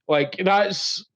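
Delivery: ordinary talk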